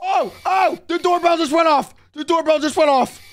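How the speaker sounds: high, urgent exclamations; screaming in alarm. Acted. Ghost hunter hearing the doorbell, high urgent alarm. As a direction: call it out in startled disbelief. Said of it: "what's with all the screaming in here".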